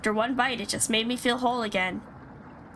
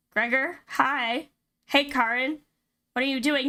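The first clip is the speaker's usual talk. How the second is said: normal talking voice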